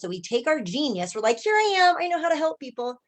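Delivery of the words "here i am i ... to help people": high, bright-eyed proclamation; eager announcement. Acted. Coach mimics eager healers offering their genius, high excited announcement. Acting out proud newcomers' eagerness.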